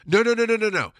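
emphatic no. Real, not acted. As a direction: wave off your own bad example. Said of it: "correcting himself loudly".

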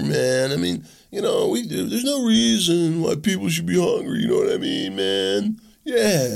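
mock a stoned college radical dreaming up big ideas; stoner liberal impression. Mocking liberal loonies smoking weed in backroom colleges, stoner voice. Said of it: "Back there going".

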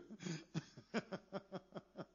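short amused chuckle. Real, not acted. Laughs at his own image.

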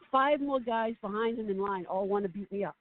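ordinary talk, nothing marked

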